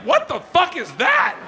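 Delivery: high, loud horrified outcry; wife's shocked shout. Retold or imitated. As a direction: react in disbelief. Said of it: "She's like".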